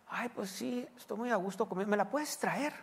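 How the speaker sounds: high, soft whiny ask; lightly mocking entitled lady; mocking lazy lady. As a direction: mimic a lazy woman wanting it brought. Lazy woman asking for delivery.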